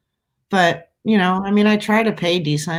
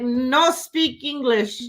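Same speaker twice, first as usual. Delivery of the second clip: put-on slow, halting German-accented broken English; 7 semitones higher, much slower than usual. Tourist waving off a ticket seller, German accent. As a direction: play a tourist brushing her off in a German accent. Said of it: "but they say it in a German accent".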